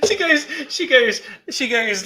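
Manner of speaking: giggling through the words; can barely get it out for laughing. Real, not acted. Retelling his mum's visit, laughing.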